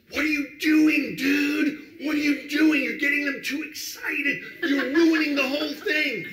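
high, loud, indignant scolding; mock-imitated star chewing out the announcer; angry scolding. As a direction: tell him off, outraged. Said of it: "she's going".

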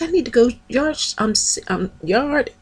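squealing high with excitement; excited gushing. Retold or imitated. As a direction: gush about it like a thrilled fan. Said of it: "I was like".

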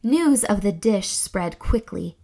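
plain reading voice

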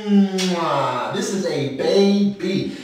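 ordinary talk

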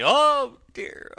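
very high, loud wailing "Oh dear"; "Oh dear" wailed high and loud; sobbing wail. Acted. Toad wailing to the driver, very high and loud. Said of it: "sobbed Toad".

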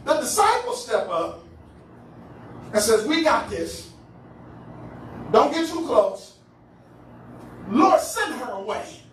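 punchy phrases with long pauses; dramatic scripture preaching. Acted. Preacher retelling woman's plea and disciples' rebuff, dramatic preaching. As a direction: act out the scene one weighty phrase at a time.

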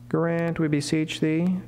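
usual speaking voice

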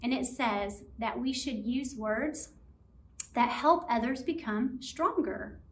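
normal talking voice

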